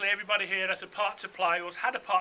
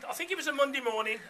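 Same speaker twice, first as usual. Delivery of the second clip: ordinary delivery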